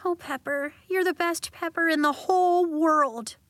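put-on high, teary voice of a girl about to cry; weepy girl thanking her best friend. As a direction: choke up with gratitude. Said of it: "Nori went weepy".

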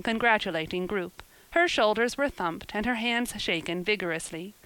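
ordinary delivery

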